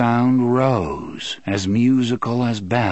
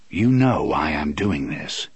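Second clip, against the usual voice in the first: low, calm, measured reply; 6 semitones lower than usual. A narrator's reading. Roland handing his gun belt to Eddie, calm. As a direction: state it calmly in a lower voice. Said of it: "he said calmly".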